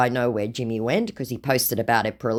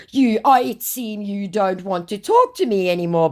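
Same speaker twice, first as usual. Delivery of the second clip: mock scammer voice; 7 semitones higher than usual. Woman reading the scammer's message aloud, in a high mock voice. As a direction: ham up the creepy flattery.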